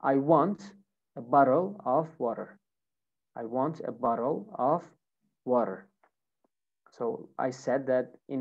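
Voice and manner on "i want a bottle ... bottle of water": American pronunciation demo for learners; American accent. Shown as a demonstration. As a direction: say it the American way, tapping the Ts. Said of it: "Now, American accent".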